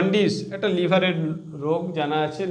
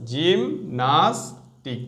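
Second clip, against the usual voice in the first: Gymnastic said very slowly, syllable by syllable; slow, drawn out; 2 semitones lower, much slower than usual. Shown as a demonstration. Teacher to students reviewing the spelling, very slow. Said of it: "জি আই এম নয় কিন্তু".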